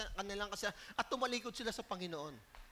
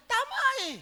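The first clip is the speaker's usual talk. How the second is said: emphatic; 11 semitones higher, 7 dB louder than usual. Real, not acted. Emphatic answer to congregation.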